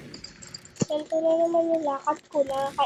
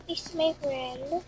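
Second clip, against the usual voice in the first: normal talking voice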